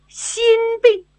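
sudden high-pitched "心病？"; surprised high question. Acted. Old consort startled by the girl's diagnosis, surprised. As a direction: catch the word in astonishment.

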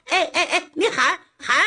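high, mocking laughter through the words; the steward laughing off the threat. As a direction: laugh at him while hushing him.

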